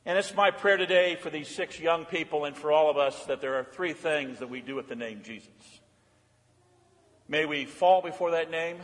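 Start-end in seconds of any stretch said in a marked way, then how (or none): none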